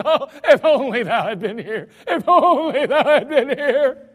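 high, loud, pleading cry; Mary begging through a catch in the voice. Acted. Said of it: "Mary comes running to him and says".

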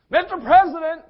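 high, loud holler; calling out. Acted. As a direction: hail someone from far off. Acts calling the president.

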